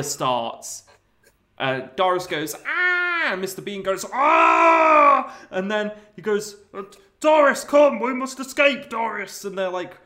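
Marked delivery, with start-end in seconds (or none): 2.7-3.3 much higher short shriek; mock scream. Doris seeing the fire, mock shriek. 4.1-5.4 much higher, much louder yell; hammed-up scream. Bean seeing the fire, hammed-up loud scream. 6.7-9.4 much higher, louder panicked call; hammed-up urgent shout. Bean urging Doris to escape, hammed-up shout.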